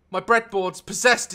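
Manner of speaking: ordinary talk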